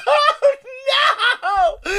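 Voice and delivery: high, laughing fake cry; hammed-up tearful oh no; mock sobbing. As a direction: wail it like a crying girl, half laughing. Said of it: "She's just like".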